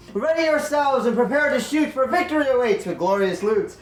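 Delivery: bardic verse. Acted. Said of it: "bardic song for everybody".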